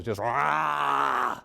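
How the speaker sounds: short rough grunt of strain; guttural strain grunt. Retold or imitated. Reliving the squats, a guttural strain grunt. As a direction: grunt like lifting a heavy weight. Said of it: "I started making noises, awful noises".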